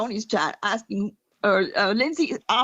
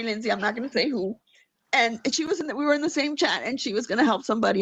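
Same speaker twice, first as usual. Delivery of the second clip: ordinary talk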